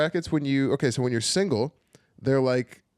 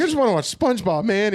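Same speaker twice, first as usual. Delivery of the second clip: put-on high, loud whining like a kid; whiny childish plea; 10 semitones higher, 6 dB louder than usual. Comic whining to the tax lady like a child. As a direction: beg like a tired child. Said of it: "I was like".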